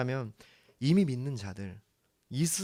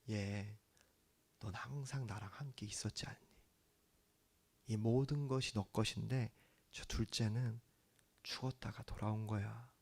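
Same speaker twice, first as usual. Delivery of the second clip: softer, whispered words of a father holding his son; tender whisper; 2 semitones lower, 9 dB quieter than usual. Acted. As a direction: breathe it into his ear while embracing him. Father embracing son, speaking softly.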